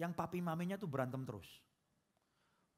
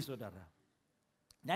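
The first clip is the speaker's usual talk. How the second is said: usual speaking voice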